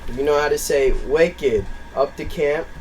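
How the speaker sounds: loud, drawn-out Maine accent. Shown as a demonstration. Showing friends how the joke should sound, hammed-up Maine accent. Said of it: "if I can read that in a Maine accent".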